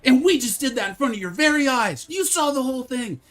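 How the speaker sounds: high, loud sales pitch voice; infomercial voice. Pretend. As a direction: sell it like a TV pitchman. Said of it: "let's tone down the infomercial".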